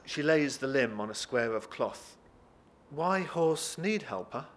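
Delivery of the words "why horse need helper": slow, higher puzzled question; halting broken English, each word spaced; baffled foreign woman. Acted. Woman misunderstands Jacob's substitute word, slow and puzzled.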